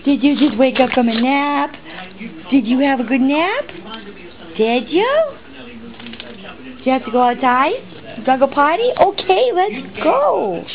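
high sing-song puppy talk. Real, not acted. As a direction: coo at the dog, pitch way up. Cooing at the waking puppy.

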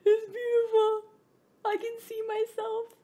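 very high, squealing with delight; awestruck squeal. Real, not acted. Gamer marvelling at her new Steam Deck, high squeal. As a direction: squeal it like a giddy fan.

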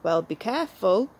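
ordinary talk, nothing marked

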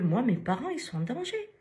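anxious protest in a retold voice; retold alarm. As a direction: pitch leaping high at the end. Retelling patient's alarm for her parents.

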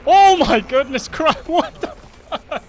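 loud, slow, disbelieving cry; shocked outburst. Real, not acted. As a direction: blurt it out in sheer shock. Sudden death after easy fight.

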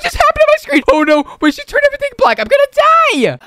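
very high-pitched mock alarm; excitable gamer fright; hammed-up panic. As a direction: cry out in playful doom.

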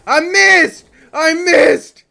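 loud, high cry of frustration; exasperated shouting; frustrated shout. Real, not acted. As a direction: yell it twice at the miss. Missed attack, frustrated.

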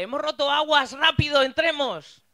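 high, loud and urgent; hammed-up movie panic; frantic movie husband. Imagined movie scene, frantic husband.

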